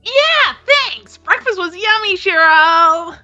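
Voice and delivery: put-on high, bright cutesy girl voice. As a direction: play it sweet and cutesy. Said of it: "Me trying to do a cutesy voice with my tomboy voice".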